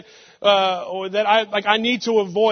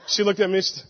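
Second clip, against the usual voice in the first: ordinary talk, nothing marked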